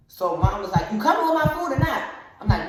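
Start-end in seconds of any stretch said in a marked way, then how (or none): 0.9-2.0 high, loud, quick demand; impatient mom yell. Acted. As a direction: shout to hurry them along. Impatient mother calling out.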